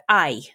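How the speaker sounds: "aye" in place of "ow", Belfast style; Belfast-sounding "aye"; Belfast vowel. Shown as a demonstration. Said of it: "they go".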